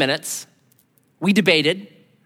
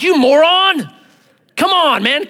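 louder, higher exasperated yell; mock harsh scolding; 11 semitones higher, 6 dB louder, slower than usual. Preacher mocks yelling at a man with a broken leg, louder. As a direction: berate someone for a dumb mistake. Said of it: "Will I be like".

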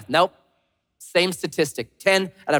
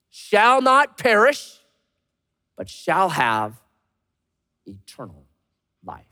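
solemn scripture recitation; slower than usual. Real, not acted. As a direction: proclaim the verse phrase by phrase, then hush at the end. Recites a famous Bible verse.

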